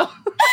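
shrieking laugh. Real, not acted. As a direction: burst out laughing high. Big laugh after joke.

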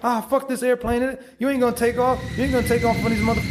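a scoffing hater jeering at a passing train; mock yelling at a train. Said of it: "someone's yelling at that train".

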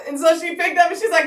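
usual speaking voice

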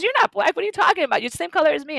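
very high, squeaky disbelief; incredulous laugh. Retold or imitated. Mother disbelieves classmate, very high and incredulous. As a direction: laugh at the absurdity. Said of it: "my mother laughs again and says".

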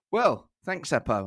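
ordinary talk

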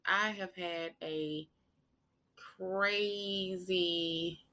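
quiet, weary, slow start; soft, tired vlog voice. Real, not acted. Quiet vlog opener after intro.

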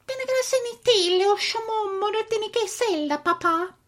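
mock Italian gibberish; hammy pretend Italian accent; fake Italian. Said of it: "You're trying to speak Italian".

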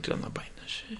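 very soft, near-whispered aside; muttered refusal. Retold or imitated. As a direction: refuse under your breath. Retells refusing a vendor.

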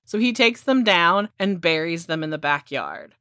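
usual speaking voice

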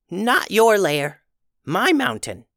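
high, slow goblin voice, stubborn; stubborn little goblin. Acted. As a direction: plant your feet like a tiny goblin king. Goblin claims the mountain.

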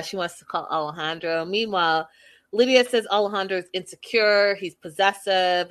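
ordinary talk

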